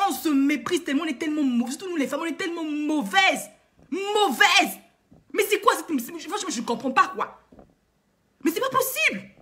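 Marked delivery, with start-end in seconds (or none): 3.9-4.8 Mauvaises hit high and loud, falling hard; emphatic repeat. Real, not acted. Repeats the word in indignation. 8.4-9.3 much higher pitch, outraged, falling on possible; exasperated disbelief. Real, not acted. Outburst of disbelief at behaviour.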